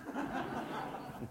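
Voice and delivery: short breathy chuckle; soft high laugh. Real, not acted. Laughing about his parents going ballistic, soft and high. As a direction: laugh it off lightly.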